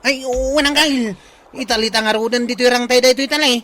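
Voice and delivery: loud, fast and high commands; shouting orders. Acted. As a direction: bark instructions during a rescue. Rescue, giving orders loudly. (agpukpukkaw nga agibilin)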